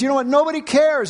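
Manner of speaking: imitating an exasperated outcry, pitched high; high-pitched retold complaint to God; crying out. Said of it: "He's crying out".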